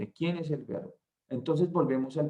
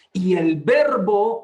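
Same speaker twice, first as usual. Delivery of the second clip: "y el Verbo" slow and much louder; stressed phrase; 4 semitones higher, 10 dB louder, slower than usual. Shown as a demonstration. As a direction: draw out "Verbo" with a rise. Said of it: "observe la expresión del verbo estar". ("y el Verbo" lento y muy fuerte)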